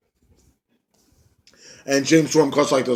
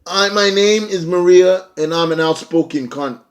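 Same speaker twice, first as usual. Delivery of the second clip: imitating Maria; 5 semitones higher than usual. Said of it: "Maria Kanellis comes out like".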